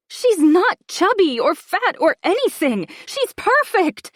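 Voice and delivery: high, loud, distraught protest; shrieking. A narrator's reading. Stepmother defends the girl to her housemate, shrieking. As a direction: climb to a shriek on the last words. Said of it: "I can hear myself shrieking".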